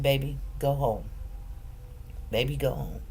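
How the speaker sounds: soft, low, gentle urging; mother gently sending daughter home; tender. Retold or imitated. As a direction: soothe her toward home.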